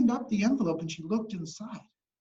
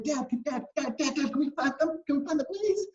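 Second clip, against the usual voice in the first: put-on high, insistent kid voice; acted excited begging; eager pleading girl; 7 semitones higher than usual. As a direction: pester Dad, rising to a squeaky please. Girl pestering dad to plant seeds.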